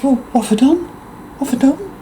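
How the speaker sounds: mimicking a narcissist playing innocent, pitched high; mock innocent whine. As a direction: play the wounded innocent. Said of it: "it's like".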